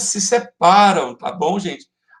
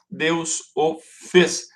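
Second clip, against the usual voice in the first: "Deus o fez" very slow and evenly separated, less forceful; syllable-count demo; much slower than usual. Shown as a demonstration. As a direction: repeat "Deus o fez" as detached, measured syllables. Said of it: "duas sílabas".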